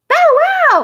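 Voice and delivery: very high, loud puppy bark; baby barking. A narrator's reading. Baby boy greeting the puppy with a high loud bark. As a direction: yip it like a toddler pretending to be a dog. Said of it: "cried Baby Louie".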